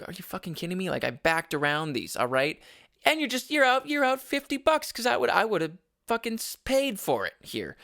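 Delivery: high-pitched indignant rant at the manager; angry outburst. Retold or imitated. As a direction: let her have it, rising in pitch. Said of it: "but I said it a bit more like".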